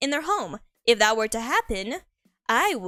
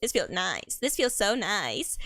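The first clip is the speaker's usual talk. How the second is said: soft, slow, lower mock contentment; mock blissful hug; 4 semitones lower, 5 dB quieter, slower than usual. Being hugged by the love interest, soft slow mock bliss. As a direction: sink into the hug, softly and slowly. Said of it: "you'll be like".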